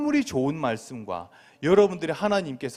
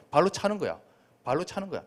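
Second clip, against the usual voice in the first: normal talking voice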